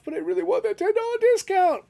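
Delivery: a high-pitched, mock-whiny plea; mock whiny craving. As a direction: whine like a kid begging for a discount. His own inner craving, quoted.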